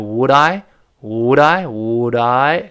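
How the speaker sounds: "would I" run together as one word, wu-dai; linked reading. Shown as a demonstration. Teacher links two words for students, run together. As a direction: glide the d of "would I" straight into the I. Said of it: "would再加I连读".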